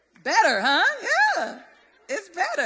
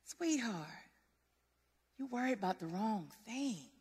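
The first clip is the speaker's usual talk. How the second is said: much softer, gentle comforting voice, almost whispered; tender reassurance; 3 semitones lower, 14 dB quieter than usual. Retold or imitated. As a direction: soothe a worried loved one. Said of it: "And he was like".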